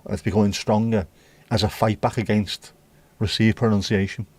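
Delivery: usual speaking voice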